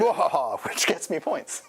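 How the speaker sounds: gloating evil laugh, then the words; sneering panto villain. Pretend. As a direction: ham up a villain's cackle. Gloating over taking first blood.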